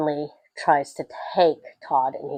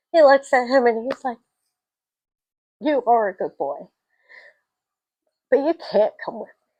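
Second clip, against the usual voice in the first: high, tearful retelling; choked up; 6 semitones higher than usual. Real, not acted. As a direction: close to tears as you tell it. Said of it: "I'm gonna cry".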